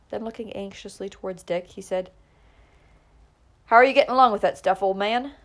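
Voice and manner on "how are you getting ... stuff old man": calling out. A narrator's reading. Calling across to Dick at case.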